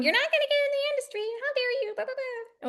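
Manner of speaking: mock them with snark; snarky. Student crew sneering at producer, snarky and fast. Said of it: "A lot of snarkiness".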